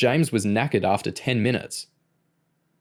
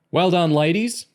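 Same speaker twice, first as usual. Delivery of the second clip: higher-pitched instructor's shout; 8 semitones higher, 4 dB louder than usual. A narrator's reading. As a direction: call out loud praise. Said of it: "Mister Large shouted".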